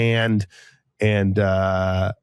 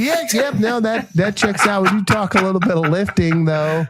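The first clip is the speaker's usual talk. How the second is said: high sheepish agreement; 9 semitones higher than usual. Retold or imitated.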